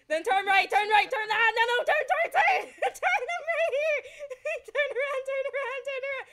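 high, loud laughing yells; frantic directions. Real, not acted. Passenger yelling turns at the driver, frantic. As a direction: shriek the turns in laughing panic.

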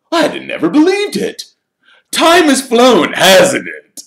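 preen like a celebrity signing photos; vain Lockhart. Acted. Reader voices Lockhart.

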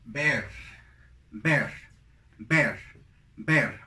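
"ver" as English bear, with an E vowel; separate, punched "ver", English bear; pronunciation of bear. Shown as a demonstration. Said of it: "La siguiente sería". ("ver" con E, como bear en inglés)

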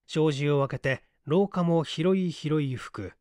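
ordinary delivery

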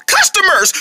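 CUSTOMERS called out high, falling at the end; high, thrilled exclamation on "Customers"; excited announcement. Acted. Vendor announcing buyers to partner, excited exclamation.

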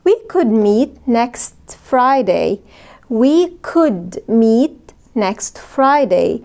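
very slow, each word clear; slow model sentence. Shown as a demonstration. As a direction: say it slowly for learners. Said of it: "ممكن نقول". (جملة بطيئة للتعلم)

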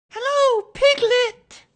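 put-on very high, loud childlike squeak; excited squeak. A narrator's reading. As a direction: squeal the greeting out at full volume. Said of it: "he squeaked".

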